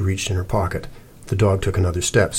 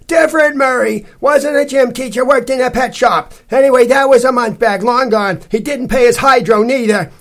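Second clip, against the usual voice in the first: high, loud, baffled woman; loud gruff landlady; 7 dB louder than usual. A narrator's reading. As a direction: shout from the porch. Stout lady rants.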